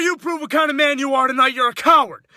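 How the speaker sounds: usual speaking voice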